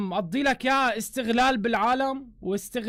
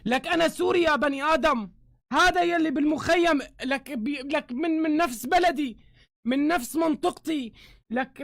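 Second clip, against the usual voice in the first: ordinary talk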